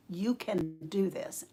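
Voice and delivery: slow, soft, steady urging; softly and slowly press the point; patient insistence. Retold or imitated. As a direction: voice the Lord calmly insisting. The Lord firmly, softly insisting.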